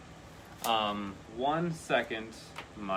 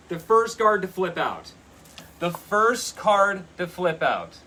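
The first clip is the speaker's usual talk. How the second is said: higher and louder, thrilled; excited; 8 semitones higher, 10 dB louder than usual. Real, not acted. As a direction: announce it with delight. Card she wanted flips out.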